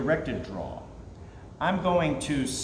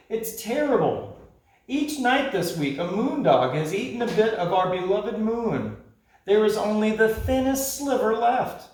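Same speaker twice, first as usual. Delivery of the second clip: high, anxious creature voice; worried creatures; 6 semitones higher than usual. Acted. Night creatures telling the parrot their fear, high and anxious. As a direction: plead with real worry.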